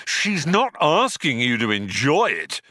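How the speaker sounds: irritated yell, moderately loud; shouting. A narrator's reading. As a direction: shout it in impatience. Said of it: "he shouted".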